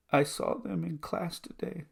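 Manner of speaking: retold son's high, tearful words; high voice, near tears, retelling a crying child. Tearful son telling dad what he saw in class, tearful. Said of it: "he starts to cry".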